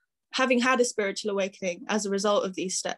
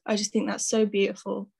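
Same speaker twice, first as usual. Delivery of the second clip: ordinary talk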